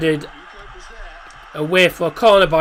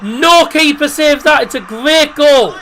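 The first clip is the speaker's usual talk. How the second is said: high, loud, slowed excited call; excited commentary; 8 semitones higher, 4 dB louder than usual. Real, not acted. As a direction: keep the goal buzz going. Describing goal, still excited.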